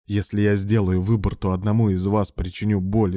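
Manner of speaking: ordinary delivery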